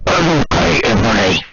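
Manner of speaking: bass-boosted Draco. Pretend. Draco checking on Ebony, booming and loud. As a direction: boom it like a bass-boosted speaker. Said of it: "Bass boosting Draco".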